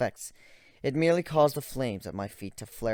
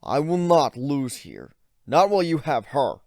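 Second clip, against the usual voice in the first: defiant growl; 5 semitones higher, 10 dB louder than usual. Acted. Ivan growling defiance at the narrator. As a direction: growl it, refusing to lose. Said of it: "he growled".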